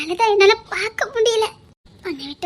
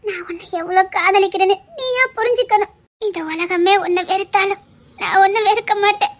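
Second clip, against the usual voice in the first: normal talking voice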